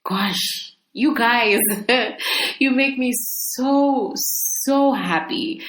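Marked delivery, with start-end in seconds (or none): none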